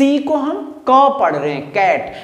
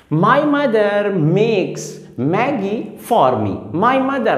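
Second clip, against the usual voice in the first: very slow, every m word drawn out; slow example reading; much slower than usual. Shown as a demonstration. Teacher reading the example slowly to show the repeated m. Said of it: "मॉ की जो sound है वो बार बार repeat".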